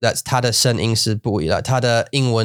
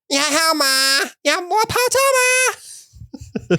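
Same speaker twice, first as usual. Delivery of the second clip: much higher, slow sweet questions; high Chinese voice; slower than usual. Retold or imitated. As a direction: offer tea in a lifted sugary pitch. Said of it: "my voice changes".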